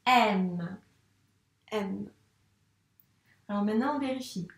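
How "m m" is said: "M" drawn out as the letters are traced; writing together on the slate; dictated while writing. Shown as a demonstration. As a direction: say the word slowly along with the pen. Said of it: "on le fait directement sur l'ardoise".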